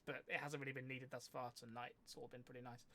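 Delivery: usual speaking voice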